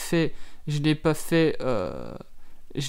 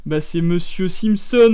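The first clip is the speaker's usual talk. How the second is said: TV host voice; 9 dB louder than usual. Pretend. TV host introducing tonight's guest, hammed-up. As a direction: play the evening talk-show host. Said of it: "ça fait genre présentateur télé".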